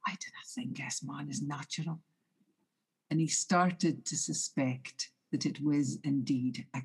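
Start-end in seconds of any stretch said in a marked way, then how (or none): 0.1-2.0 whispered, much softer, under the breath; hushed whisper. Acted. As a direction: breathe the thought out in a frightened whisper. Fisherman's fearful inner thought.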